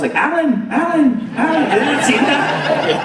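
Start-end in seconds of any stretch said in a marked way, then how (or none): 0.2-1.6 high, silly animal call; animal voice-over. Retold or imitated. Quoting silly animal videos, high voice-over. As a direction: imitate a dubbed animal calling out. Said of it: "where they voice over the animals".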